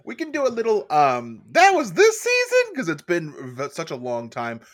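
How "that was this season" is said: high, loud squeal of disbelief; shocked disbelief voice. Acted. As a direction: squeal it out like you can't believe it. Mock disbelief voice about the show.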